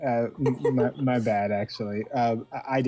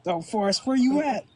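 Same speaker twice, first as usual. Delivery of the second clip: calling out; 10 semitones higher than usual. Real, not acted. Calling out for Forrest.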